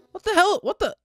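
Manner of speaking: loud, high, incredulous outburst; shocked disbelief. Real, not acted. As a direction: exclaim in baffled horror. Shocked at game scene.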